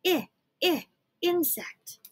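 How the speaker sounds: "I, I" clipped then insect; sounding out insect for kids; short i sound. Shown as a demonstration. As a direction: model the short i. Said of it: "That's the sound that I makes".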